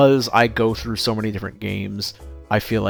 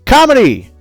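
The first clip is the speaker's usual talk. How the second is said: shouted announcement; 13 semitones higher, 10 dB louder than usual. Real, not acted. Shouting a section title.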